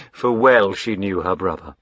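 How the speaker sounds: usual speaking voice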